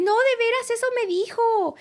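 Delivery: very high mock-earnest imitation of mom; mimicking mom. Daughter mimics mom insisting it's true, very high. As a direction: squeak out mom's insistence.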